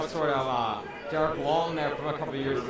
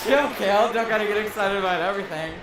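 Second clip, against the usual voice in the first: teasing; 5 semitones higher, 6 dB louder than usual. Real, not acted. Teasing partner's loud call.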